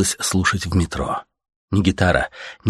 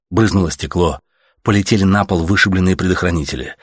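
ordinary delivery

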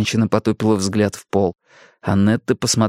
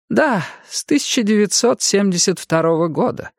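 высокий старческий голос; ласковая пожилая женщина; старушка; 11 semitones higher than usual. A narrator's reading. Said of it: "кивнула милая старушка". (голос милой старушки)

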